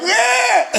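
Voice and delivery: high exasperated yelp with a laugh; half-laughing cry of frustration; frantic cry. Retold or imitated. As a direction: let out a desperate squeal. Frantic at a tangled mess.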